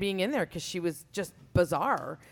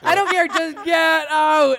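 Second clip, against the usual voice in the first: loud slow command; 6 semitones higher, 7 dB louder, slower than usual. Retold or imitated. Teacher ordering student out.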